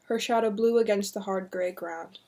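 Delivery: ordinary delivery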